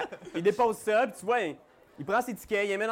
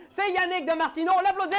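excited announcer; 10 semitones higher, faster than usual. Real, not acted. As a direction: cheer the guest in. Announcing the special guest loudly.

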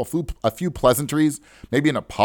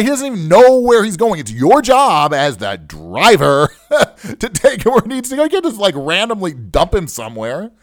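high, louder indignant argument; pitched-up protest with a brief laugh; retold yelling; 7 semitones higher, 5 dB louder than usual. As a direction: re-enact telling off the driver. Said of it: "so I started yelling".

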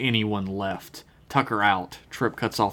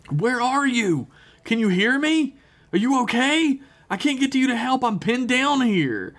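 high, urgent calling over the comms; a pinned-down alien pleading high; frantic Shran voice; 14 semitones higher than usual. Acted.

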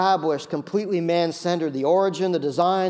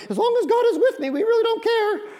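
high, laughing mock voice of careless people; mocking shallow believers; 4 dB louder than usual. Preacher mocking the people of Dan, squeaky falsetto.

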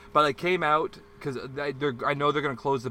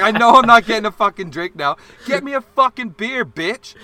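much higher, much louder yell; retold furious shout; 9 semitones higher, 11 dB louder than usual. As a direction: scream at the bartender in fury.

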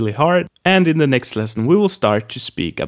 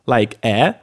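usual speaking voice

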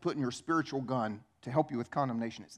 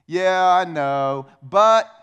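dismissive agreement; 10 dB louder, much slower than usual. Retold or imitated. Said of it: "look right back at me and say".

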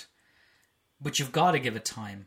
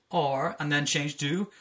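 ordinary talk

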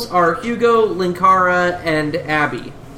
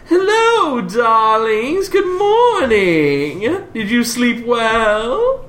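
high, slow, gushing darling voice; loud, swooping theatrical greeting; Hugo; 6 semitones higher, 3 dB louder, much slower than usual. Acted. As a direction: greet them grandly, drawing out every word. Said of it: "And Hugo chimes in".

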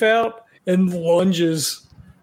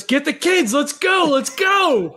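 urgent high-pitched shout; yelling; 8 semitones higher than usual. Acted. Nash orders the group to get the kids, yelling. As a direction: yell it out high, rallying everyone to move. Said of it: "I think Nash just yells".

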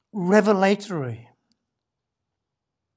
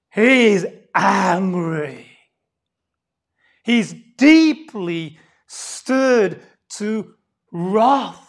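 much louder, very slow, each word weighted; heavy slow emphasis; 10 dB louder, much slower than usual. Shown as a demonstration. Preacher to congregation, slow loud emphatic declaration. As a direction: hammer home the wrath word by word. Preacher drives home Jesus' anger.

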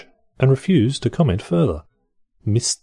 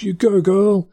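high-pitched mock cheer; high, playful voice of an encouraging woman; 10 semitones higher, slower than usual. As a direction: put on a high voice and cheer her on.

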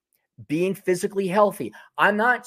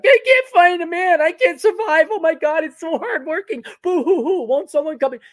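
high, loud, whining and sobbing; whiny mock voice; 12 semitones higher, 5 dB louder than usual. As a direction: fake boo-hoo whine. Impersonating whining TikTok women.

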